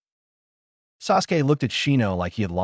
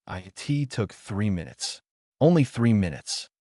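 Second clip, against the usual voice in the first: plain reading voice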